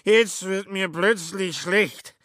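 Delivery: high, loud and slow bellow. A narrator's reading. Sick Schmitz bellows at Kobler, high and loud. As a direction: roar it out, about to be sick. Schmitz repeats, more urgent.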